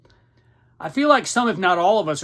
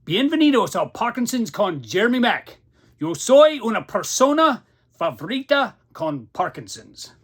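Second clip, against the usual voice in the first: hammed-up TV host greeting, higher and louder; mock Spanish show host; 6 semitones higher, 5 dB louder than usual. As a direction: play a grand Spanish-speaking showman welcoming the crowd.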